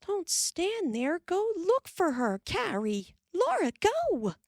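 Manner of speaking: high, urgent orders. A narrator's reading. Ma orders the girls to search, urgent and high. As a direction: push the girls to search, anxious. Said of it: "Ma said".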